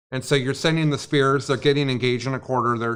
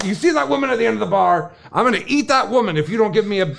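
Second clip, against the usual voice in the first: mock-menacing bear, pitched up; threatening bear; 6 semitones higher, 3 dB louder than usual. Joke bear threatening the bartender, mock-menacing. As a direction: ham up a bear making threats. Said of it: "And the bear says".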